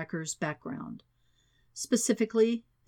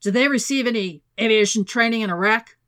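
loud, insistent question; demanding; 4 semitones higher, 8 dB louder, faster than usual. A narrator's reading. Vice president questioning the FBI on hijackers, loud and demanding. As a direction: demand an answer, raised voice.

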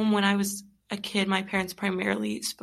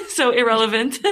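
laughing; 2 semitones higher, 7 dB louder than usual. Real, not acted. Laughing at own remark.

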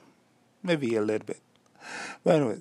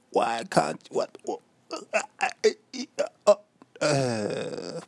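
sputtering, higher-pitched confused protest; mock irritation; 5 semitones higher than usual. Podcaster reenacting annoyance at a new word, irritated sputter. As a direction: stammer it out as if the mind jams. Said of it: "It irritated my mind".

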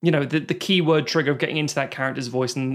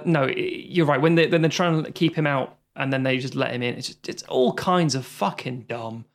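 usual speaking voice

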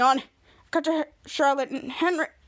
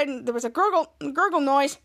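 usual speaking voice

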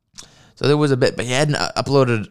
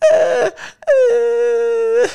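much higher, strained cry; mock straining whimper; 4 dB louder than usual. Imagining hugging a giant, straining whimper. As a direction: squeak like you can't reach around.

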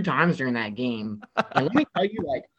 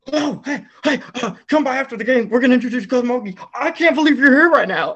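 much higher and louder, a rush of starstruck excitement; freaked-out excitement; 9 semitones higher, 7 dB louder than usual. Retold or imitated. Radio host meeting his regular caller, gushing with high excited delight. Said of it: "so freaked out".

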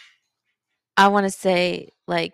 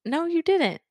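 higher, softer mock disbelief; disbelieving reaction; 9 semitones higher, 6 dB quieter than usual. Listener reacting to an unbelievable story, mock disbelief. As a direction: act out doubting what you just heard.

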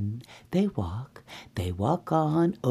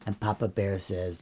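usual speaking voice

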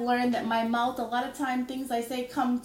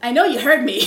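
pointed callout; 4 semitones higher, 12 dB louder than usual. Retold or imitated. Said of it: "And she's like".

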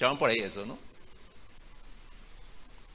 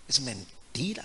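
hushed whisper, no voice; whispered accusation; 6 dB quieter than usual. Retold or imitated. Pastor whispering to the lying boy. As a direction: whisper it right at him.

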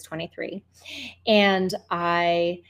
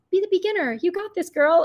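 encouraging angel; 11 semitones higher, 4 dB louder than usual. Retold or imitated. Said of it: "it was like the angel".

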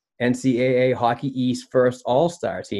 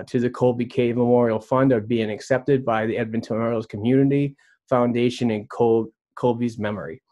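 normal talking voice

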